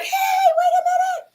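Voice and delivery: high, loud call across the street. Retold or imitated. Flagging down a cab driver, a high loud call. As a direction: hail him down before he goes.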